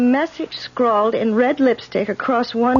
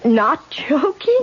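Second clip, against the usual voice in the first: alarmed question; 5 semitones higher, much slower than usual. Acted. Alarmed at the suggestion.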